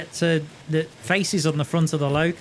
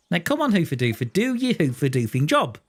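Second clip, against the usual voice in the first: ordinary talk, nothing marked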